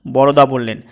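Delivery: ordinary delivery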